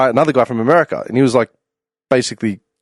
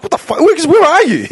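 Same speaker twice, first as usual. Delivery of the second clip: dad's high, startled voice jumping up; mock outraged dad on the phone; imitating his dad. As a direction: act out the shocked father on the phone. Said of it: "He's like".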